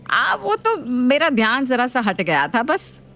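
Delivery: sheepish mother-in-law. Acted. Mother-in-law slowly excusing her own mistake. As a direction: hem and haw.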